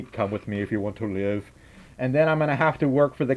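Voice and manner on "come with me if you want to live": low, quiet alien summons. Pretend. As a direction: play the alien, dropped low and soft. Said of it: "an alien's gonna come out".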